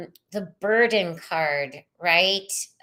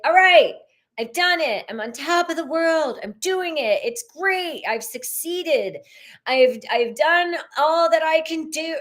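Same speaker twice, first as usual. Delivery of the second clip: higher, proud triumphant voice; 8 semitones higher, 3 dB louder than usual. Retold or imitated. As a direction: crow about your success. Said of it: "you're like".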